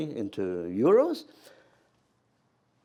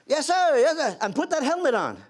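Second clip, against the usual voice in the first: high, louder flustered yes sir; hasty yes sir; 14 semitones higher, 6 dB louder than usual. Retold or imitated. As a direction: scramble to obey. Frightened private answering major.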